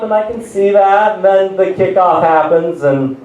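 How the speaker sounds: ordinary talk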